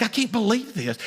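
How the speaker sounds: stunned disbelief. Retold or imitated. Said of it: "It's shock".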